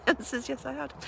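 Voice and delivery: giggling as she says it again; laughing at her own joke. Real, not acted. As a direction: repeat the answer through a laugh. Repeats the joke answer.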